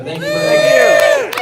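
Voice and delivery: shouted thanks. Real, not acted. Closing a rally speech.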